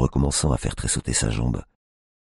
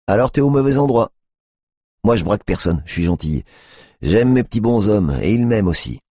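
higher, louder sing-song protest; mock sweet; 7 semitones higher, 4 dB louder than usual. A narrator's reading. Tiffany faking innocence to the cop, higher and louder. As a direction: play innocent, high and loud.

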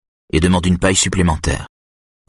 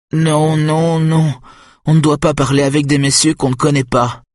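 put-on scared boy refusing; 9 semitones higher than usual. A narrator's reading. Nick refuses to talk to a stranger, frightened. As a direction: repeat the rule in panic.

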